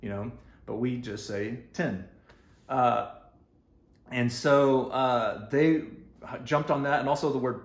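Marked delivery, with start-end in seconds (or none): 0.7-2.0 "tin" with a short I, like the metal; Texas tin. Shown as a demonstration. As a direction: say "tin" the Texas way, ten and tin the same. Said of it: "some people say ten versus tin".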